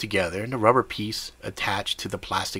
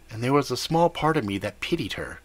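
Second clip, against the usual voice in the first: ordinary delivery, nothing marked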